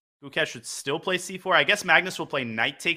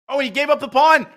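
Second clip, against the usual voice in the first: excited; 9 semitones higher, 6 dB louder than usual. Real, not acted. Time scramble excitement.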